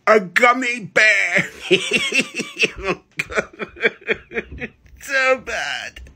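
high giggling delivery; laughing. Real, not acted. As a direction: crack up through it. Punchline then cracking up.

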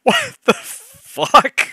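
high, slow, loud incredulous cry; can't believe what she just read; stunned disbelief. Real, not acted. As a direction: drag it out in pure disbelief. Disbelief after laughing.

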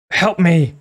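high, loud, shrill plea; frightened pleading. Acted. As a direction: beg for help in fright. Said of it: "pleaded in a shrill, frightened voice".

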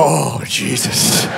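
slow, deep "oh Jesus" in disbelief; low shocked gasp. Retold or imitated. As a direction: drop low and drag it out, appalled. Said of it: "made me go".

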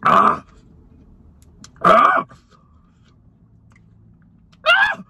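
gasping ahs, rising to a shout; pained gasps from spicy heat. Real, not acted. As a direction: cry out as the heat hits. After biting spicy sauce.

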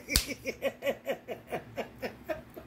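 soft trailing giggle; fading laugh. Real, not acted. Laughing at the beetle story, soft trailing giggle.

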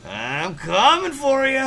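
drawn-out taunt. Acted. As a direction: stretch it out like a cartoon villain.

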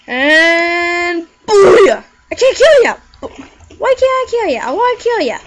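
high, loud frustrated cry; frustrated yell. Real, not acted. Frustrated attack in game.

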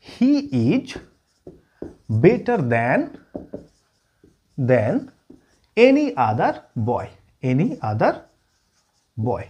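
halting chunks, repeating words as they are written; slow dictation. Shown as a demonstration. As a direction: piece by piece, with long pauses. Teacher dictating while writing sentence.

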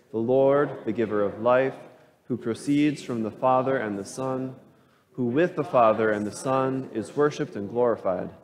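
ordinary talk, nothing marked

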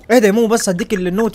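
ordinary talk

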